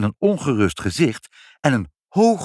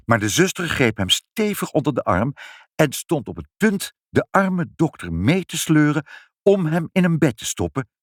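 usual speaking voice

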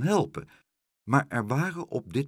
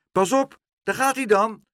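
high, loud warning call; 12 semitones higher, 7 dB louder than usual. A narrator's reading. Boy starting the crane, calling out. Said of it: "riep hij".

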